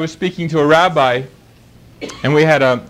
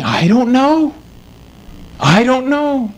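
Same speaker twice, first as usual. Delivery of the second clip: higher, anxious voicing of the rabbi's doubt; preacher acting out the old rabbi's fear of hell, in a higher, worried voice; imitating the troubled rabbi; 6 semitones higher, slower than usual. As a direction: let the rabbi's uncertainty come through, voiced higher. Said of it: "And he was saying".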